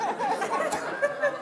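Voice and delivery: high burst of laughter. Real, not acted. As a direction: laugh at the joke. Laughing at own joke.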